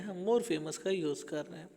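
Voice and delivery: ordinary delivery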